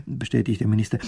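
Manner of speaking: usual speaking voice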